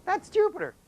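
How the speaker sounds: big, bright announcement of "Jupiter"; loud, high exclaim. Shown as a demonstration. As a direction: call out "Jupiter" like you want the back row to hear. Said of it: "I said it so quietly you couldn't hear me".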